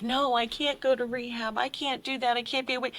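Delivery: high, mocking whine of her own excuses; mocking her old excuses. Guest mocking her old refusals of rehab, a high whine. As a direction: parody the person who kept refusing rehab. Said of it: "that whole concept of".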